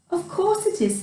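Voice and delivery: high, bright mouse agreement; Mouse agreeing. Acted. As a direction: chirp it gladly. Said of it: "said Mouse".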